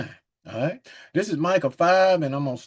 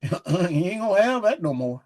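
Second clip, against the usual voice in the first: ordinary delivery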